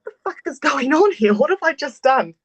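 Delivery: rising into a loud, high disbelieving outburst; suddenly realizing the mess you're in; shocked realization. Retold or imitated. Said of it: "you're like".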